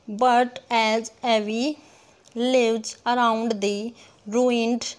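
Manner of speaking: very slow verse, word by word; syllable-by-syllable scansion. Shown as a demonstration. Teacher reads a line for counting, slowly syllable by syllable. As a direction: give each beat its own space. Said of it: "जितने हम टुकड़ों में pronounce करेंगे".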